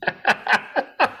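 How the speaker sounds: real helpless laughter through the words; can't hold the laugh in; cracking up. Real, not acted. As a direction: struggle to talk while laughing, pitch jumping high. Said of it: "without cracking up".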